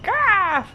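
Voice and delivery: high sharp ah; mock pain cry. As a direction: yelp like a twinge. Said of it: "kinda like".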